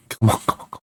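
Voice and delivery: rapid whispered urging; hushed nervous chant to the keeper; tense whisper. Real, not acted. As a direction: whisper it, barely breathing.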